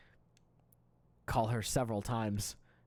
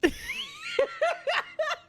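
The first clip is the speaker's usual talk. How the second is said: a loud helpless laugh; 4 dB louder than usual. Real, not acted. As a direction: burst of high laughter. Laughing at own story.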